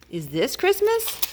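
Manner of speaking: high, slow wondering owl; little owl voice. A narrator's reading. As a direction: ask it like a small lost owl. Owl voiced in read-aloud story.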